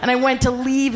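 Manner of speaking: usual speaking voice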